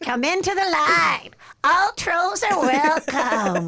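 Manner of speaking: high, loud, slow troll call. Pretend. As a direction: welcome them like a bridge troll. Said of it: "My troll, my troll voice".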